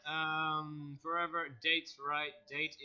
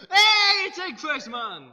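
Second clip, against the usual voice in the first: loud and much higher on hey; mock delighted welcome; excited greeting; 10 semitones higher, 10 dB louder, slower than usual. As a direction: hail him like a celebrity. Greets a funny-looking applicant.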